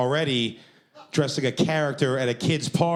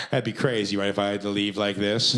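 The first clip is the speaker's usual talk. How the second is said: nervous little laugh, then casual question; feigned joking; 3 semitones lower than usual. Retold or imitated. Performer pretends serious host is kidding, feigned joking. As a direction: play it off as if he's kidding. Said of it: "trying to act like he was kidding".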